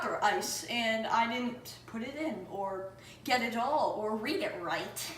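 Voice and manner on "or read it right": ordinary talk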